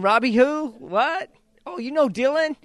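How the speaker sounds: high, loud mock confusion; hammed-up stranger, then lighting up; mock puzzled stranger. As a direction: play a coffee clerk who can't place him. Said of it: "They don't remember who I am".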